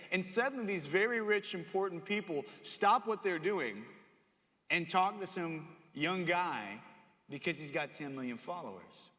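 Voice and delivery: ordinary talk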